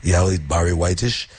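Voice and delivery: ordinary talk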